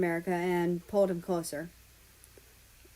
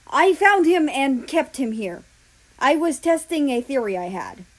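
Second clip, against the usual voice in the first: desperate protest; 6 semitones higher, 8 dB louder than usual. Acted. Germany pleading while arrested.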